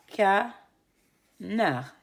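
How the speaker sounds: loud, drawn-out ca-nard; two clear beats; syllable split. Shown as a demonstration. Teacher to kids, ca-nard syllable by syllable. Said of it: "Deux syllabes".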